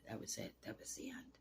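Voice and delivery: words whispered, barely voiced; breath-only whisper, holding back tears; real hushed grief. Real, not acted. Telling the listener how the friend died, in a real hushed whisper.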